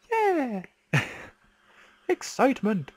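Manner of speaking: high, loud cheer of relief; real excitement. Real, not acted. Player cheering that the game finally didn't lag, high excited cheer. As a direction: whoop it out, genuinely thrilled.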